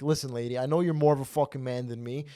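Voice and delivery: usual speaking voice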